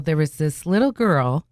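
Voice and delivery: usual speaking voice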